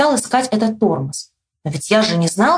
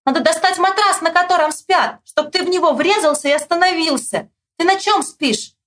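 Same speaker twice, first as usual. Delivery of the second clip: high-pitched calling of instructions; high storybook shout; 8 semitones higher than usual. A narrator's reading. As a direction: holler a plan to someone riding by. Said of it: "Мишка кричит".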